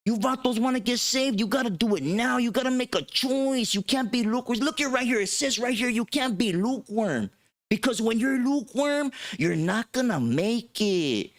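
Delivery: high, urgent preaching; imitating fiery preacher. Pastor urging the vatos to choose now, high fervent preaching. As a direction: hammer it home like a street preacher.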